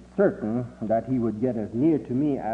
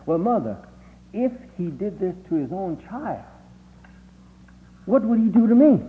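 rising higher in pitch and louder toward the question, ending anxious; pleading child; 8 semitones higher, 3 dB louder than usual. Retold or imitated. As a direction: push the question up high to his mother. Boy pleads with his mother.

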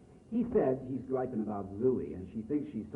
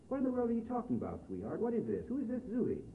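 higher, quick, faux-puzzled put-on voice; mock innocent voice; 10 semitones higher than usual. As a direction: play dumb in a higher pitch. Zooey feigns ignorance on phone.

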